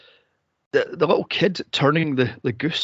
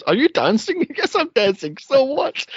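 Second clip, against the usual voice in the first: heckler voices; 11 semitones higher, 3 dB louder than usual. Retold or imitated. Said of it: "He goes".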